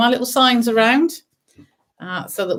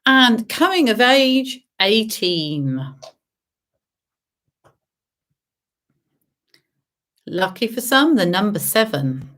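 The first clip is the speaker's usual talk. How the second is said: bingo caller; slower than usual. Acted. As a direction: sing out the numbers. Bingo calls.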